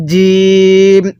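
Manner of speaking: জিম pronounced firmly, long-held vowel; hard জিম. Shown as a demonstration. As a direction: press hard on জিম and stretch it slowly. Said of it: "এরকমভাবে পড়তে হবে". (জিম শক্ত করে টেনে)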